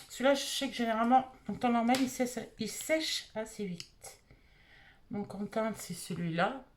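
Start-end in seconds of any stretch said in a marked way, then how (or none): none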